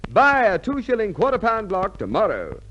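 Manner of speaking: usual speaking voice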